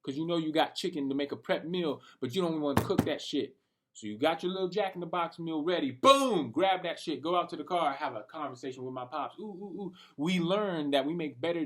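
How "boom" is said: louder and higher, punchy; excited boom. Real, not acted. Hyping his Jack in the Box meal to viewers, excited. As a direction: pop the word out. Exclaiming food ready.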